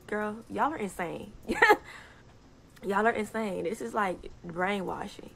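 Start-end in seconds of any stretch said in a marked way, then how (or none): 1.4-2.0 sharp high laugh of disbelief; high burst of laughter. Real, not acted. Laughing at viewers calling her insane.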